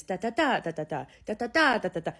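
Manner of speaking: "da, da, da" in lilting groups of three, rising and falling; Italian speech rhythm. Shown as a demonstration. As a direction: bounce "da, da, da" up and down like Italian. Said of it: "the rhythm of Italian is".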